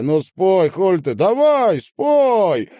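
high, loud demand; loud high nagging shout; insistent urging. A narrator's reading. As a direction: badger him loudly, pitch climbing to a yell. Girl keeps shouting for a song.

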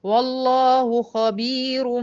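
خبير with a heavy ر, recited slowly; slow recitation carrying through خبير; heavy ra, no stop. Shown as a demonstration. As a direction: recite والله خبير بما يعملون in slow Quran style. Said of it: "منونة بالضم".

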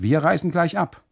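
hoch und laut ausgerufen; gerufen. A narrator's reading. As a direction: energisch hinausrufen, deutlich höher. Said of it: "rufe ich". (lauter, hoher Ruf zum Kustoden)

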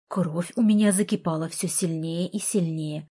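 plain reading voice

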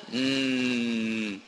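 ordinary talk

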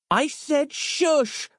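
high-pitched, drawn-out librarian bellow. A narrator's reading. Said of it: "bellowed Samantha".

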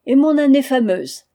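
sulky complaint at being forgotten; half-cross. Acted. Young prince reminding the narrator of his question, half-cross. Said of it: "d'un ton demi-fâché".

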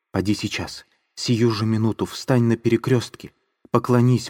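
ecstatic, pressing demand; trembling fervor. Acted. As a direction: plead with burning conviction. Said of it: "спрашивала она его, вся дрожа, точно в припадке".

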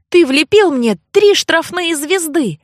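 high, heated accusation; indignant outburst. A narrator's reading. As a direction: hurl the accusation at them. Heroine accuses him in quarrel.

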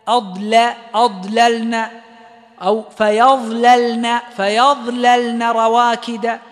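أضللنا and فيظللن slow with thin lams; light lam. Shown as a demonstration. Teacher drilling light lams for students, slow. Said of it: "رقق اللام".

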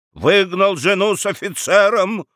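high, grandiose declamation; with pathos. A narrator's reading. Lawyer correcting himself mid-speech, with pathos. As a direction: declaim the accusation as if in court. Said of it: "продолжал с пафосом Рамкомф".

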